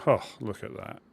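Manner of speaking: delighted surprise at a lucky find; surprised exclamation. Real, not acted. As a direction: blurt it out as the find catches you off guard. Finds loot, sudden exclamation.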